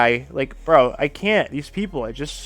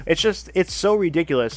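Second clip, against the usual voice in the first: usual speaking voice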